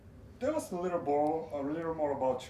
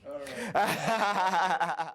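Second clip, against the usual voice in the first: all right, laughed out loud and bright; breaking into laughter on the words; laughing; 6 semitones higher, 5 dB louder than usual. Real, not acted. Interviewer laughing at the rapper's boast, laughing.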